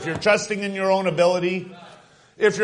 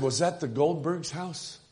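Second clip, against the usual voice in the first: much softer, a small voice in the dark; hushed frightened boy; 3 semitones lower, 8 dB quieter than usual. Acted. Boy asking his father about the neighbours' cries, hushed fearful question. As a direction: ask it under your breath, afraid.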